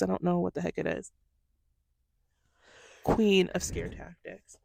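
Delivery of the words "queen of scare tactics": slow, deliberate "Queen of scare tactics"; slow emphatic repeat. Real, not acted. Repeats phrase slowly.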